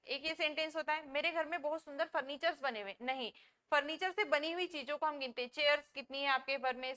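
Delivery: usual speaking voice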